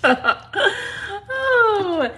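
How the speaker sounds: high laughing oh. Real, not acted. To viewers, joking about her Southern accent, laughing. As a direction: laugh it out at yourself.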